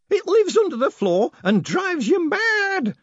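very high, loud, drawn-out relish; relishing woman. A narrator's reading. Woman describing the curse to Moist, enjoying it. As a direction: savour the last word, stretching it. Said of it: "enjoying the syllable so much".

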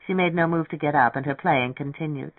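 ordinary delivery

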